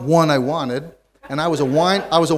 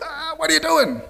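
surprised wife; 14 semitones higher than usual. Retold or imitated. Wife asks where he's going.

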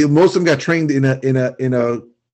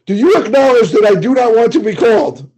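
mock caller; 7 semitones higher, 4 dB louder than usual. Mocking the caller.

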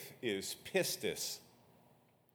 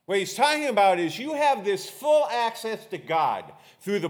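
raised, forceful pulpit voice; preaching; 6 semitones higher, 5 dB louder than usual. Real, not acted. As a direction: drive each point home to the congregation, high and emphatic.

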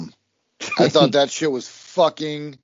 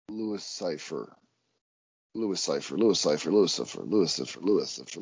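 low mock repetition; 8 semitones lower, 6 dB quieter than usual. Repeating name mockingly.

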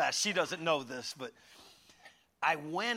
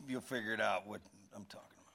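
soft, fast lower mutter; quiet aside; 8 semitones lower, 8 dB quieter, faster than usual. Real, not acted. Preacher mutters a quick aside to the church. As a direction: toss it under your breath.

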